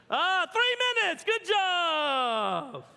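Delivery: high, loud mock cheer for the kids; cheering shout. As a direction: cheer them on across the yard. Dad cheering kids' race.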